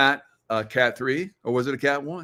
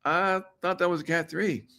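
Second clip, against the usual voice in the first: puzzled crowd; 7 semitones higher, 4 dB quieter than usual. Retold or imitated. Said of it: "And everybody's like".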